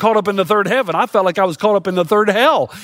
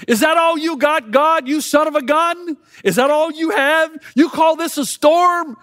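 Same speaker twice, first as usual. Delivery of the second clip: high, raised voice hurling a challenge; defiant shouting at God; 7 semitones higher than usual. Retold or imitated. Lieutenant Dan defying God in the storm, shouting. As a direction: dare God to do his worst. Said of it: "in his frustration, he says to God".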